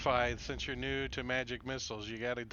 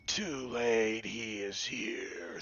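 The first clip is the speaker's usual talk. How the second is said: slow, drawn-out last words; drawn-out dying words; 4 semitones lower, much slower than usual. Acted. Game master voices man taking the arrow, drawn-out dying words. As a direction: stretch it out like a falling victim. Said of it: "he's like".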